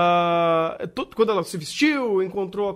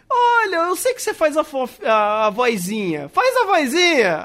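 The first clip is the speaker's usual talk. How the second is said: higher and louder mock plea to the voice actress; a half-hearted whine of a client asking for the cute voice; imitating a casting director; 5 semitones higher, 5 dB louder than usual. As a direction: beg her for the cute voice, whiny and playful. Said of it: "todo mundo que contrata ela fala".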